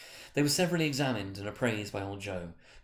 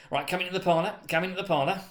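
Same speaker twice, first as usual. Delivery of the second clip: higher, louder welcoming call; hearty old man; 8 semitones higher, 5 dB louder, faster than usual. Acted. Old Joe calls the women into the parlour, hearty. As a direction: beckon them in like a cheery old fence.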